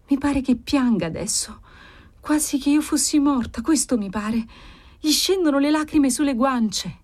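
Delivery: put-on plaintive girl in a trance; entranced daughter, sorrowful. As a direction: report the vision in a high, mournful voice.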